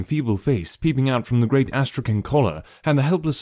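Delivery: plain reading voice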